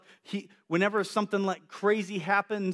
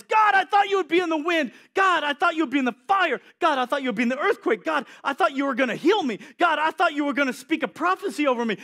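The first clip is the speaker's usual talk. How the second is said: higher, louder impassioned preaching; preaching at full force; 9 semitones higher, 6 dB louder than usual. Acted. Preacher crying out to the congregation, louder and impassioned. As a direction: drive it home to the room.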